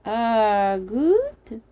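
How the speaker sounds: drawn-out, unsure foreign voice; confused. Acted. Stranger answering about milk, confused. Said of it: "a response that sounded confused".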